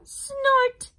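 high, loud machine snort. Acted. Big snort machine snorts at baby bird, loud and high. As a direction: blast the snort like a big engine. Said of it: "The big thing said".

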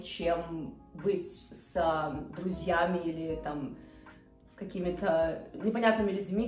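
ordinary talk, nothing marked

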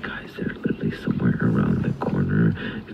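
hushed whisper; whispering while hiding. Real, not acted. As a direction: whisper so the monsters can't hear. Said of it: "'cause I can't be loud".

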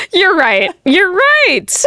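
high, laughing you're right. Real, not acted. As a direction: laugh through the words. Laughing at the joke.

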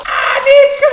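high startled cry at spitting oil; startled yelp. Real, not acted. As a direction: jump back and yelp. Hot oil spitting, yelling name. (grido spaventato)

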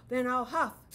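wolf threatening the stick house; roaring wolf threat. Acted. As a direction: roar it out like the wolf.

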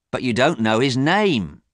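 indignant brother, pitch pushed up; angry. A narrator's reading. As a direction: protest crossly, rising onto the last word. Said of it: "said James angrily".

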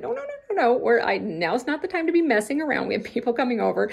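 high, fast flustered refusal; a frazzled host saying no, no, no; mock panicked no. Herself panicking on holiday.

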